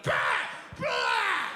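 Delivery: loud, high tantrum cry; bratty wail. Retold or imitated. Kid without TV, a loud, high tantrum wail. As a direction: wail like a furious toddler.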